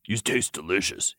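low, soft purr. A narrator's reading. Lucian compliments the lover, purring. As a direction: purr it quietly. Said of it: "he purred".

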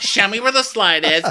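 high, loud diva demand; mock demanding. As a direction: imitate an outraged, whining guest. Acted guest demanding the slide.

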